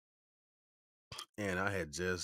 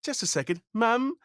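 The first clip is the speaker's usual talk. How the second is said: put-on very high, loud little British boy; British-accented child; 10 dB louder than usual. Ten-year-old answering the house phone, high British accent. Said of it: "this is me at ten".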